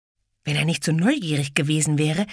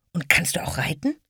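whisper; 3 semitones higher than usual. Acted. Ede whispering question.

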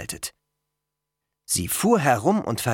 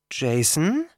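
tentative, slightly raised question; cautious question; 4 semitones higher than usual. A narrator's reading. Girl addresses the boy everyone stares at, cautious. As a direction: ask it carefully, pitch lifting. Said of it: "fragte Annabeth vorsichtig".

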